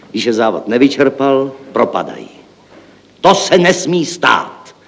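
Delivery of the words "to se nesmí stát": high, drawn-out cry of alarm; exclaiming. Acted. Chief accountant protesting to colleague over lost funds, exclaiming. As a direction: cry out slowly, pitched up. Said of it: "zvolal hlavní účetní".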